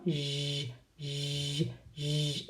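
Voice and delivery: long, low buzzing "Zh"; sustained "zh" with voice, no vowel; voiced S sound. Shown as a demonstration. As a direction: repeat "zh" as a held consonant, softly. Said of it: "It is our voiced S".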